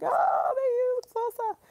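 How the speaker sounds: high, slow, mock voice of her friends; imitating friends. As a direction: quote the friends in a high put-on voice. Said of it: "and they were like".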